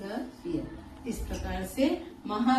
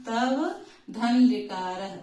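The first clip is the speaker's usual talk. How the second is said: slow dictation; much slower than usual. Shown as a demonstration. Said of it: "इसी नियम के हिसाब से देख लेते हैं".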